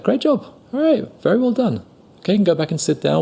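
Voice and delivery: high, brisk praise; praising teacher. Retold or imitated. Teacher praising the student, high then quick. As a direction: praise him like a showy teacher. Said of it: "the teacher was like".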